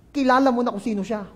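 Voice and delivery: ordinary delivery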